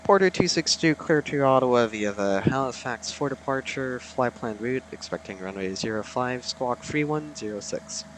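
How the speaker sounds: brisk ATC phraseology read back over the radio; pilot radio readback. Acted. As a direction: read the clearance back like a real airline pilot on frequency. Pilot reads back ATC clearance.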